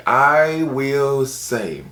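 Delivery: "I will say" stretched very slowly; very slow, theatrical "I will say"; drawn-out emphasis. Pretend. Repeats phrase, stretched for effect.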